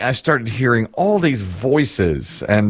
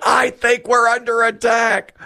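much higher, slow, hammed-up panic; mock alarm; 11 semitones higher than usual. Retelling his wife's repeated warning: hammed-up alarm, much higher. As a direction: dramatize her alarm again, drawn out. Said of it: "She's like".

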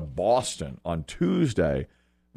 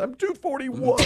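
indignant reply; 14 semitones higher than usual. Retold or imitated. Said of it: "I said".

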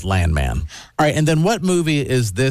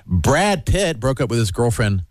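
ordinary talk, nothing marked

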